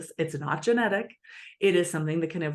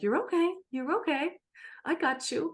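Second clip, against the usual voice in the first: gentle reassurance; 10 semitones higher than usual. Retold or imitated. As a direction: hold the child close with a gentle coo. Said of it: "a secure way to say".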